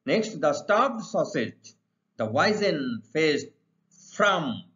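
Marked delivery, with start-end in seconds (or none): none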